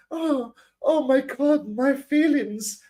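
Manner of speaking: very high, slow, overdramatic whimper; mock a woman with hurt feelings; hammed-up offended female voice, dragged out; mock wounded woman. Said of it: "talk like that to a woman, they're like".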